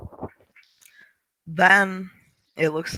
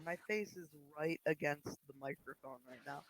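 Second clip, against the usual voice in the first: faint, distant mic sound; like speaking through a wall; far away; 24 dB quieter than usual. Real, not acted. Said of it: "You sound so far away".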